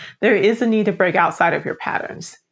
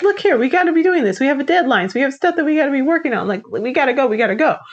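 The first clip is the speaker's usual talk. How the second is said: higher, fast and insistent; pushy coworker; 5 semitones higher, faster than usual. Retold or imitated. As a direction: hurry them along about deadlines. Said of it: "She's like".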